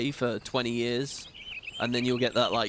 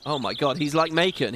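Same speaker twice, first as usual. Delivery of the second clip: ordinary talk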